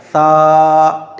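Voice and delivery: सा with the tongue against the front teeth. Shown as a demonstration. Teacher demonstrating correct सा, tongue on teeth. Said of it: "अब आपको सा निकालना है".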